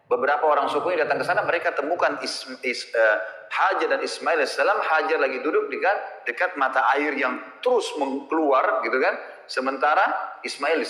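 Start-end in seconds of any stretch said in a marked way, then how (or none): none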